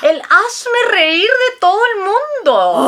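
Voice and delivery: high-pitched, scornful tirade; indignant scorn. Acted. Mother condemns her son-in-law to her daughter, indignant. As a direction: denounce him, pitch up throughout.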